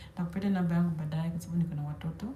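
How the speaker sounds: ordinary talk, nothing marked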